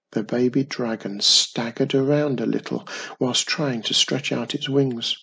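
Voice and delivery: ordinary delivery, nothing marked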